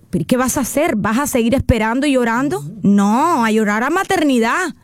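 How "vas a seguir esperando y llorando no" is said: ordinary talk, nothing marked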